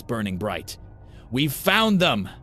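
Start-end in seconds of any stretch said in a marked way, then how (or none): 1.3-2.3 high triumphant shout to a crowd; crying out. A narrator's reading. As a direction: yell it out so the whole mob hears. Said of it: "Gracie cried to the crowd".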